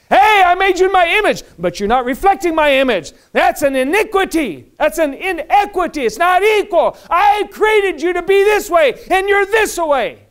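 high, shouted divine reproach; loud, high preacher voicing God; God rebuking. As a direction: shout it like an indignant God confronting his creation. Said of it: "God looks at us".

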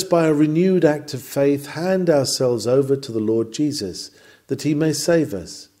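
usual speaking voice

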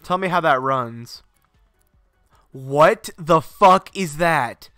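ordinary talk, nothing marked

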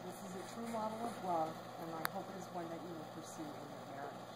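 measured formal ceremony voice; wedding officiant. Acted. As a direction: speak like an officiant at the altar. Officiant's sermon on love.

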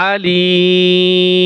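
আলিম with a long held "ee", ending on a plain m; only mim sounded. Shown as a demonstration. Teacher showing only mim is pronounced, long held vowel. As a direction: draw out আলিম and close the lips on m. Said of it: "শুধু মিম উচ্চারণ হবে".

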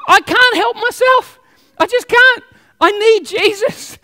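high-pitched, drawn-out mock plea; pleading. Preacher acts out needing Jesus, high helpless plea. Acting out desperate need for Jesus.

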